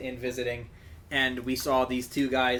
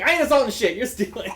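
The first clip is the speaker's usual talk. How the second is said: loud, higher comeback; defiant retort; 8 semitones higher, 6 dB louder than usual. Retold or imitated. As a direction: snap back at him. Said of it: "It's like".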